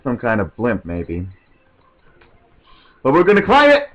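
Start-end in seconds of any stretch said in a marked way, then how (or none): 3.1-3.9 high, loud hammed-up rallying cry; mock-heroic. Gamer declares why we climb it, mock-heroic. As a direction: stretch the ending out into a playful high wail.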